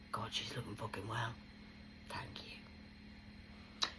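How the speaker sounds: soft unvoiced aside; imitating people admiring her, whispered; whispered compliment. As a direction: hush it like gossip.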